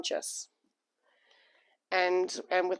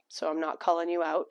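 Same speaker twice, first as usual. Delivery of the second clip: usual speaking voice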